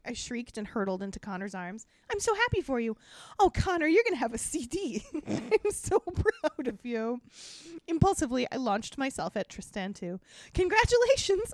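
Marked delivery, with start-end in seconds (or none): 2.1-7.2 gushing. A narrator's reading. Darcy hugging Connor over the record deal, excited. 10.5-11.5 high, loud howled congratulations. A narrator's reading. Darcy shouting congratulations at Tristan, high and loud. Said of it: "I howled at him".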